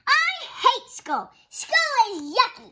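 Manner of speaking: put-on high, slow whining little girl; whiny child tantrum. Little Betty sulks at her teacher, high whiny. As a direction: pout and whine it out.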